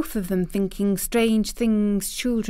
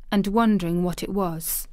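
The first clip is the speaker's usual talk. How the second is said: ordinary delivery, nothing marked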